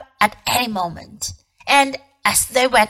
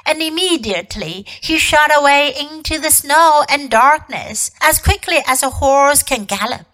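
plain reading voice

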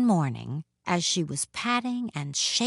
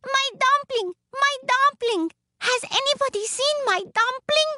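very high, frantic calling; high-pitched little woman on the run; crying out; 4 dB louder than usual. A narrator's reading. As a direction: call for it in a piping little voice.

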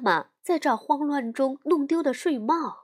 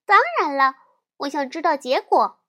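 insistent; 3 semitones higher, 7 dB louder than usual. Acted. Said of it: "小熊妹妹坚持说".

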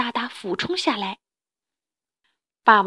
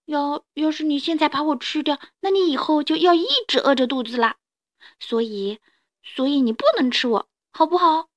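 put-on higher-pitched baby dinosaur bargaining for its life; pleading little dinosaur; 4 semitones higher, 3 dB louder than usual. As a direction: plead earnestly as a small dinosaur.

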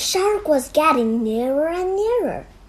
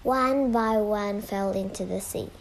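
plain reading voice

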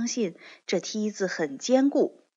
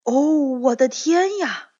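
higher, louder wondering exclamation; amazed cry; 6 semitones higher, 7 dB louder than usual. Acted. Lion and tin man looking over the wall, higher louder awed cry. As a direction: exclaim in awe at the city. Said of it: "也都说".

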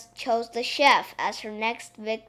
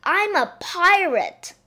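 put-on higher, slow proud little girl; bossy little princess; 7 semitones higher, 5 dB louder than usual. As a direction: announce it like a tiny captain. Princess proclaims herself pirate.

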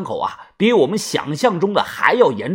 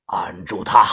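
stern order; 9 semitones lower than usual. A narrator's reading. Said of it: "黑着脸大声地说道".

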